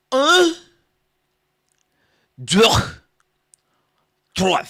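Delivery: high loud yelped count; mock French counting. Comic counting in French, high loud yelps. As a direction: squeal un deux trois high and loud.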